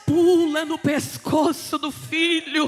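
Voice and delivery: full-force preaching. Acted. Preacher telling of the father embracing his son, building fervently. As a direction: build the reunion to a preacher's cry.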